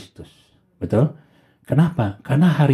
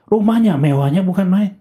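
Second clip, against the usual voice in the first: ordinary talk, nothing marked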